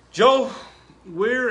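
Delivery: loud call across the room; loud harsh voice. Real, not acted. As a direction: call out loudly to a friend. Calling out to a friend.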